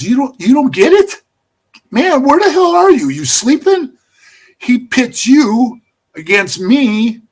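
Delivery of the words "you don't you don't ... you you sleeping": raised pitch and volume, incredulous; retold exasperation at a clueless buddy; exasperated disbelief. Retold Jimmy scolds narrator for not getting it.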